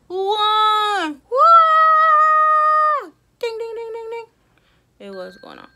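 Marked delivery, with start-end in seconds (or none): 0.1-3.1 high, loud celebratory whoop; excited cheer. Real, not acted. Countdown ends, celebrates. 3.4-4.2 high sung ding ding; timer ding imitation.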